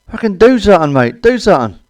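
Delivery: high, loud desperate plea; panicked yell. Retold or imitated. Hanging from the car window, yelling at the driver.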